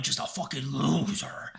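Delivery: slow breathy whisper; whispered insult. Retold or imitated. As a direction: hiss out the insult. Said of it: "But then I'm like".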